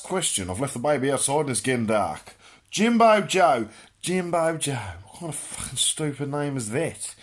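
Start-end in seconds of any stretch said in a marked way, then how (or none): none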